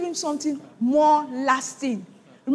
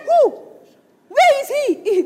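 loud, high-pitched acted shock; shaken; 8 semitones higher, 5 dB louder, slower than usual. Preacher playing shaken Isaac questioning Esau, loud shock. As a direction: cry it out higher and louder, as if shaken. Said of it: "The man shook".

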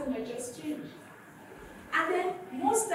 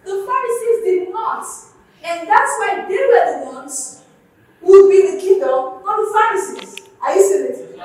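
rising, louder preacher's cry building through the point; preaching at full force; 7 semitones higher, 13 dB louder than usual. Acted. Pastor pressing the congregation to see it, full-force preaching. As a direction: drive it home to the congregation, louder and higher.

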